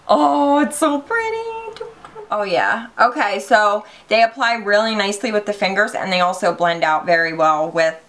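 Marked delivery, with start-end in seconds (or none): 0.1-1.9 very high, drawn-out coo; slow, squeaky swoon; adoring squeal. Real, not acted. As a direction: gush in a tiny high voice. Gushing over the highlighter shade.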